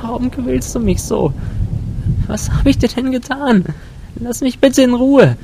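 put-on very high, thin girlish plea; frightened high-pitched pleading. A narrator's reading. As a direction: beg in a small, high-pitched voice. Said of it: "flehte sie mit dünner Stimme".

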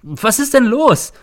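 high, loud, drawn-out shout. A narrator's reading. Teacher learns of the death, shouting. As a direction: shout the question in shock. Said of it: "schreie ich".

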